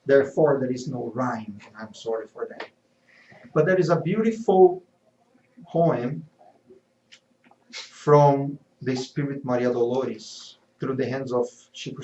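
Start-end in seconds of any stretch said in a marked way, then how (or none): none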